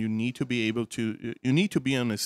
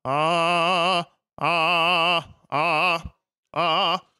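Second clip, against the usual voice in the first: louder, heavier spooky tone; heavy ghost voice; 5 semitones higher, 7 dB louder, much slower than usual. Shown as a demonstration. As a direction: push weight into the ghost sound. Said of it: "If I make it heavy".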